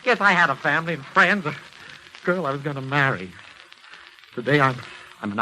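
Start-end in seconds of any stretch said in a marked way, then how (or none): 0.0-3.3 anguished outburst. Acted. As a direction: cry out in exasperated despair. Patient despairs at the doctor.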